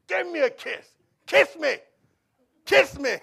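loud, high, playful demand. Retold or imitated. Father chases his daughter for a kiss, loud and playful. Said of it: "She get".

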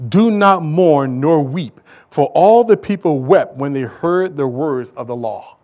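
slow, loud, stretched pleading of the verse; fervent scripture reading. Acted. Preacher reading the verse to his congregation, slow and fervent. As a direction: read it like a preacher pressing the point.

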